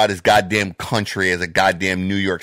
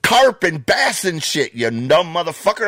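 higher and louder, raging insults; furious rant; 9 semitones higher, 3 dB louder than usual. Real, not acted. Podcaster rants at [name] over alligator fishing, furious and raised. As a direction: let it fly, real contempt and fury.

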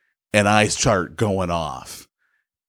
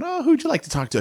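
high chirpy receptionist voice; sing-song phone receptionist voice; mock receptionist; much faster than usual. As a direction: imitate the gal answering the phone, pitched up. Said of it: "I get some gal on the phone".

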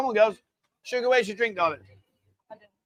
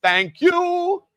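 bright, much higher, sing-song thanks; cute high thank you; 11 semitones higher, 6 dB louder than usual. Shown as a demonstration. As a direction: squeak it out sweetly for the camera. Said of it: "You could be a bit more, you could say".

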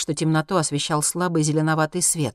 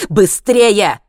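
loud, urgent raised command; shouting; 5 semitones higher, 12 dB louder than usual. A narrator's reading. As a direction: shout to hurry them up. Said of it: "закричал Хайман". (громкий крик)

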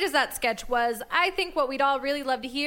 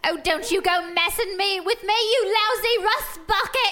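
higher, louder indignant shout; angry kid yell; 7 semitones higher, 3 dB louder than usual. Acted. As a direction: yell at the rust bucket. Kid yelling at robot.

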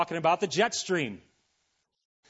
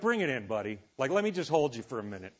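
lower, softer, like hugging a sad friend; consoling hug; 5 semitones lower, 4 dB quieter, faster than usual. Pretend. Preacher comforting a gloomy Solomon, mock tender. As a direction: comfort a gloomy buddy, bring it in. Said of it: "it's like".

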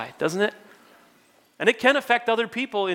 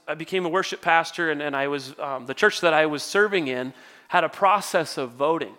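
ordinary talk, nothing marked

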